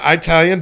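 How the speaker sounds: "Italian" with a long "eye" at the start; own pronunciation. Real, not acted. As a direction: say "Italian" as eye-TAL-yun. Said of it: "and I still say Italian".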